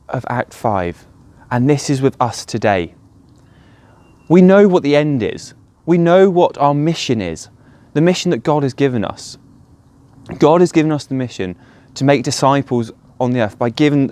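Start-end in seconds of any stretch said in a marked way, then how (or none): none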